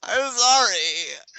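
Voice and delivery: high, drawn-out fake whimper; hammed-up pitiful wail; mock pleading apology. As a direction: whine out an exaggerated apology. Mock apology after jumping the gun.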